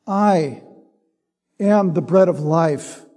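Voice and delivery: "I" standing alone, then a long pause before "am"; stress on I. Shown as a demonstration. Preacher reading John six to the congregation, stress on "I". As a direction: isolate "I", wait, then give the rest evenly. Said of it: "The stress is on the "I".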